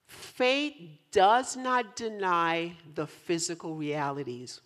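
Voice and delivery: each word drawn out and spaced; measured, emphatic slow delivery; slow, deliberate statement. Shown as a demonstration. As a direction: say it slowly so listeners really hear it. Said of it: "I'll say it slow".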